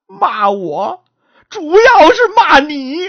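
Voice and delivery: put-on old mother blames her daughter loudly; indignant complaint. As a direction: lean hard on every word.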